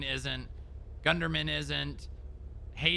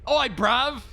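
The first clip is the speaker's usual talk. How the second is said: hammed-up Cockney call, higher and louder; mock British accent; 9 semitones higher, 7 dB louder than usual. Cop joking in mock British accent to partner. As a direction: bark it like a London lad. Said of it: "talking like I'm British".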